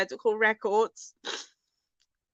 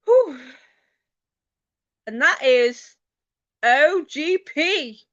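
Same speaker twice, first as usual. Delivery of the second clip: excited winner reveal; 4 semitones higher, 6 dB louder, slower than usual. Real, not acted. Announcing the last giveaway winner.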